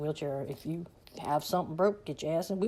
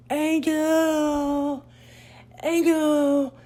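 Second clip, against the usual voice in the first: much higher, louder mock yell; mom's loud silly yell; 11 semitones higher, 9 dB louder, much slower than usual. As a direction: bellow the name to embarrass the kids. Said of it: "acting mentally challenged at high volume".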